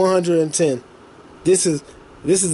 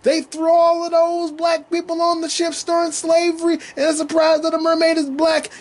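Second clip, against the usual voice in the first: quoting a tweet; 9 semitones higher than usual. Retold or imitated. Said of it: "and I quote the tweet".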